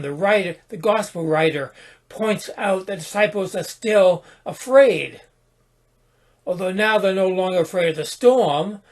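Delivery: usual speaking voice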